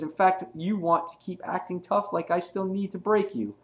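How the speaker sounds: high-pitched retold warning; acted villain threat.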